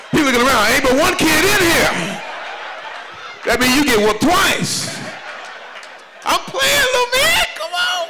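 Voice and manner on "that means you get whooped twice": usual speaking voice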